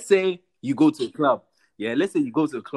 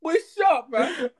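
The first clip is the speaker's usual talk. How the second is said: high-pitched exasperated rebuke; mock scolding dad; 11 semitones higher than usual. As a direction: playfully shut the kid down. Acts out dad rebuking his son.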